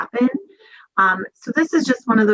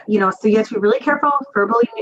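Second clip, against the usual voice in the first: normal talking voice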